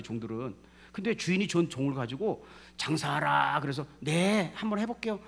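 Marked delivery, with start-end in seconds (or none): 2.8-3.6 firm, louder order; projected command. Acted. Master orders servants to trade, projected command. 4.0-5.2 eager reply. Acted. Acts servants' eager reply.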